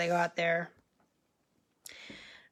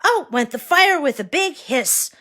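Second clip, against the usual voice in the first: usual speaking voice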